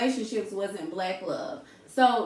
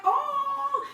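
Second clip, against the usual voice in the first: touched gasp. Retold or imitated. Woman moved by old man's anniversary, high gasp. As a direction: melt at the sweet story.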